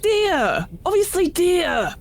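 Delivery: high-pitched indignant outburst; acted defensive yelp, drawn out at the end; exasperated protest. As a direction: protest it as if utterly obvious. Hunter answers hostile questioning.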